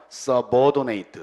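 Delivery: subordinate drawn out syllable by syllable; slow demo. Shown as a demonstration. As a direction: sound out subordinate very slowly. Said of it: "중요한 단어 나오네요". (subordinate를 아주 천천히 또박또박)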